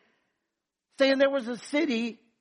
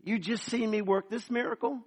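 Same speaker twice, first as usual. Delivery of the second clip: ordinary talk, nothing marked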